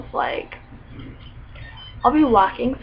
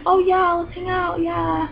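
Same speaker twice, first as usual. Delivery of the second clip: fake eager friend; 6 semitones higher, slower than usual. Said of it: "they're like".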